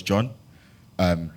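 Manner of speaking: nervous stammering with repeats; stuttering. Retold or imitated. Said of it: "Femi really stutters".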